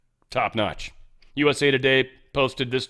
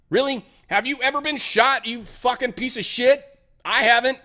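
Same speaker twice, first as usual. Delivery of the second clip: angry outburst; 9 semitones higher than usual. Real, not acted. Angry rant at CNN.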